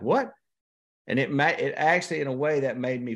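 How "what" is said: incredulous high yelp of surprise; can't believe what he just heard; sudden high disbelieving what; shocked what. Retold or imitated. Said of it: "And I'm like".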